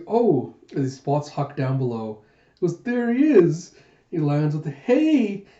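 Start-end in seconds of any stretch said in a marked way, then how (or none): 2.8-3.8 high loud spotting call; eager greeting from above; excited call. Acted. Jayha spots Huck. 4.7-5.4 high loud hey; bright greeting shout; cheery shout. Acted. Jayha landing.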